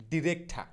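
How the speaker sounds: ডিরেক্ট ঠা joined, ending in aspirated "tha"; corrected pronunciation. Shown as a demonstration. Teacher correcting director, ডিরেক্ট ঠা with open final "tha". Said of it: "কি বললাম".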